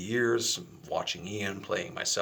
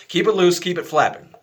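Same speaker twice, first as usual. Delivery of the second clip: louder and higher, driving the point home; emphatic repeat; 8 semitones higher, 10 dB louder than usual. Shown as a demonstration. Teacher repeats the key instruction, louder and higher. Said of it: "laughs; I know how that sounds".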